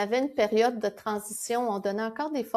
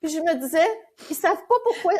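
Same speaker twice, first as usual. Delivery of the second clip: higher, louder, amused retelling; laughing; 5 semitones higher, 4 dB louder than usual. Retold or imitated. Telling why students unknowingly funded the renovations, laughing. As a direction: retell it through rising laughter. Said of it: "C'est que je riais".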